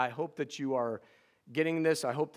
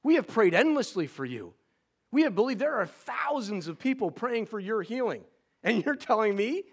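retold frustration; 6 semitones higher than usual. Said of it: "frustrated and saying".

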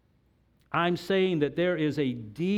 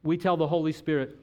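ordinary talk, nothing marked

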